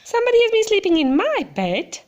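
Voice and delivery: Mama Bear bursting out in alarm; quick startled exclamation; exclaiming Mama Bear. Acted. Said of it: "she exclaimed".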